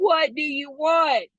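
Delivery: slow, drawn-out hero plea; slow mock Noah impression; Ryan Gosling as Noah. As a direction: stretch it out like the movie hero. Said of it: "My best Ryan Gosling".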